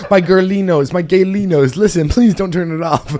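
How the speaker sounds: loud, high playful plea to listeners; excited calling out. Real, not acted.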